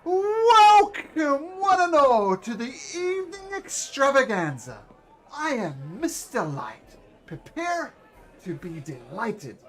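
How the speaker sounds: booming high showman's welcome, then slowing down; grand ringmaster. Acted. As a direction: announce it like a circus ringmaster to the crowd. GM voices ringmaster Mister Light.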